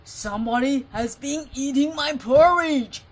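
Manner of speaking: loud, booming papa bear; Father Bear's big voice. Acted. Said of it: "said in his great big voice".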